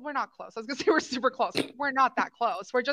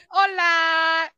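mock Spanish greeting; 5 semitones higher than usual. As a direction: toss off a half-joking Spanish accent. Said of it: "I would try to do my fake Spanish accent".

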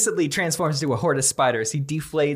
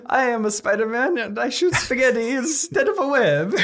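put-on high, comic Italian accent; 10 semitones higher than usual. Italian Spider-Man boasts about shooting spaghetti, comic Italian accent. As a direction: put on a silly Italian voice, much higher. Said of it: "with an Italian accent".

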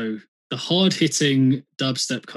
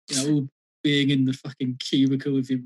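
ordinary talk, nothing marked

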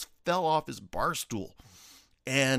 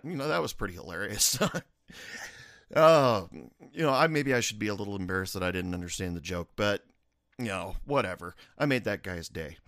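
normal talking voice

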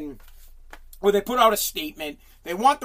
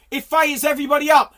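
ordinary delivery

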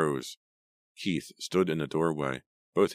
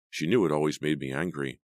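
ordinary delivery

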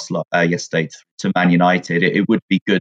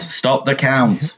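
slow, higher chant ending up; mock slogan again, rising; 7 semitones higher, much slower than usual. Co-host repeating the joke, slow rising mock chant. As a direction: drag it out and lift the end. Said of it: "repeats joke chant".

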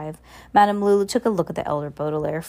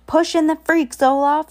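high, loud heckle from the crowd; crowd shout; 10 semitones higher, 6 dB louder than usual. A narrator's reading. Someone in the crowd urges Olaf on, shouting. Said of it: "someone shouted".

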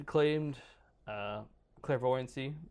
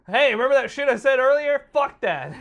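loud, high jeering; hammed-up yell, much louder; mock gleeful shout; 12 semitones higher, 13 dB louder than usual. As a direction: taunt the creature like a boastful shooter. Creature-killer mock taunt.

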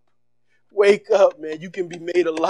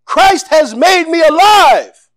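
much higher and louder, slow triumphant cry of "I'm alive"; screaming; 9 semitones higher, 8 dB louder, slower than usual. Retold or imitated. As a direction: shout it out, reborn and defiant. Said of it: "he screams".

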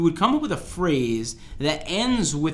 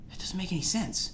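quiet, high-pitched bafflement; softly puzzled aside; baffled; 5 semitones higher, 9 dB quieter than usual. Real, not acted. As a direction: say it under your breath, bewildered. Baffled by the slang he explains.

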